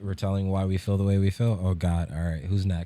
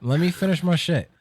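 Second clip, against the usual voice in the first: loud; 9 semitones higher, 5 dB louder than usual. Real, not acted. Cut off, demands to finish.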